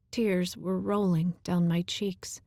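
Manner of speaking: usual speaking voice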